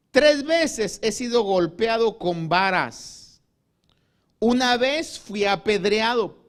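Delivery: slow, loud, weighty preaching; solemn emphatic reading. Acted. Preacher declaiming Paul's list of sufferings, slow and loud. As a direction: let each hardship land slowly.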